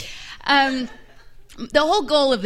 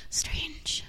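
whisper; 3 semitones higher, 11 dB quieter than usual. Acted. Whispered aside.

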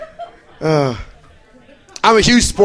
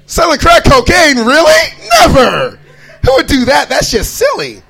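much higher, loud scandalized disbelief; mock outrage; 6 semitones higher, 4 dB louder than usual. Naive teen scandalized by rap lyrics, loud. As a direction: gasp at it like it is unthinkable.

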